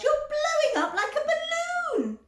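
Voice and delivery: very high, loud screech. A narrator's reading. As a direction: screech in horror. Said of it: "screeched Missus Beauregard".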